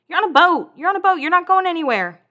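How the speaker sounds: high, loud mock exasperation; exasperated exclamation. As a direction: exclaim it high, as if stating the obvious. Exclaims at Heidi's demand to leave.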